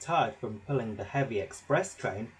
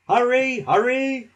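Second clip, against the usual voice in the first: loud, high mocking call; teasing; 11 semitones higher, 11 dB louder than usual. A narrator's reading. Gordon teasing Thomas to hurry. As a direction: tease him as you pass.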